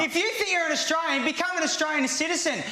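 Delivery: high, heated yelling at him; angry shouting. Real, not acted. Housemate arguing about citizenship, furious high shouting. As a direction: shout him down across the room.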